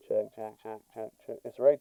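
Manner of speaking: a distant, thin check, check; mic check with bad EQ. Real, not acted. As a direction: test the mic through a bad filter. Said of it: "It sounds horrible".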